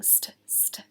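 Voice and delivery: "st, st" whispered, voiceless and soft; whispered st sound. Shown as a demonstration. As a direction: breathe out "st, st" like a secret sound. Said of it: "the same consonant cluster".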